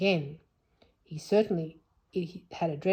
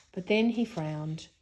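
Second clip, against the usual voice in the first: ordinary delivery, nothing marked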